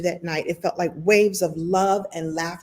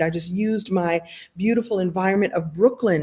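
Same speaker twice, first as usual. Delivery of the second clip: ordinary delivery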